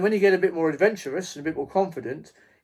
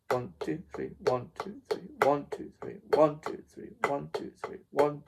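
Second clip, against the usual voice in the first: soft counting the triplet; 5 dB quieter than usual. Shown as a demonstration. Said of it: "So it's emphasized on the third beat".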